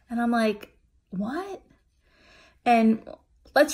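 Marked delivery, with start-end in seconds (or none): 1.1-1.6 high, softer, rising question; disbelieving. Retold or imitated. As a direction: re-enact a small, incredulous question. She reenacts her own disbelief.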